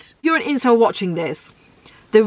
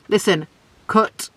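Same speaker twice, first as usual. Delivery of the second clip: ordinary talk, nothing marked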